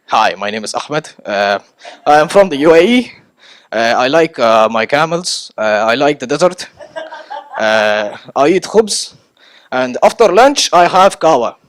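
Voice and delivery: a hint of an awkward newcomer listing his likes; awkward rambling intro. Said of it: "Do you really wanna sound like that".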